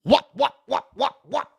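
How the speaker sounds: high, loud rap ad-lib whats. Retold or imitated. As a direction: mimic the rapper getting excited. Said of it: "His thing is he goes".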